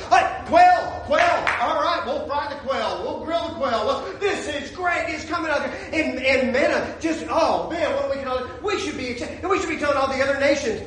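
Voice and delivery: high-pitched, gleeful excitement; acted delighted traveler. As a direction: exult over each new gift, hammed-up. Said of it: "Well, they should have gone".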